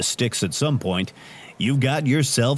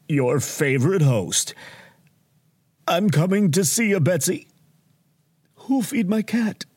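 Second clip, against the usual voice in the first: mock-tragic, high, breaking off between gasps of feeling; a staged dying swan of a host; hammed-up dying; 8 semitones higher than usual. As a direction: overplay a melodramatic deathbed farewell. Host fakes his own dying.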